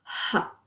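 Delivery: Ha breathed out as if fogging glass; Ha whispered from the throat; foggy whispered ha. Shown as a demonstration. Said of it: "trying to fog it up".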